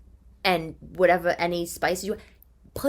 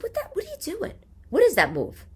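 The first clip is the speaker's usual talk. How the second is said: high shocked mother's question; shocked mom. Retold or imitated. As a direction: react like a stunned mom. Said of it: "I said".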